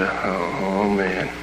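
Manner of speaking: low, soft groan of realisation; sinking dread. Acted. DJ learns the boss is on the line, hushed dread. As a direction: let the voice drop and soften as trouble dawns. Boss caught friend answering phone.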